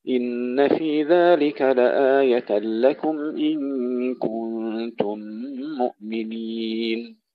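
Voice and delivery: slow melodic tajweed recitation; Quran recitation. Acted. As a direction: recite the verses with measured chant. (تلاوة مجودة)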